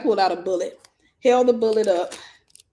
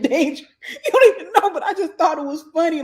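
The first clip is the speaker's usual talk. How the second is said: high-pitched words breaking into laughter; real laughing; 8 semitones higher, 6 dB louder than usual. Real, not acted. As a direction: laugh through the retelling. Laughing at mom's retold scolding.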